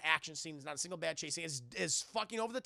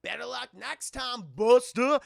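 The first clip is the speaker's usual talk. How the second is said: higher, slow gloating taunt with a loud last word; mocking taunt; 6 semitones higher, 11 dB louder, slower than usual. The Rock taunting Shaw before hanging up, gloating. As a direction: gloat over the phone. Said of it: "He's like".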